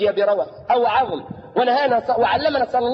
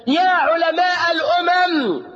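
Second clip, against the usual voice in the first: solemn proclaiming quote; 5 semitones higher, slower than usual. Acted. Preacher reads the Baha'i text to his audience, slow and declaiming. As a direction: proclaim it slowly like scripture.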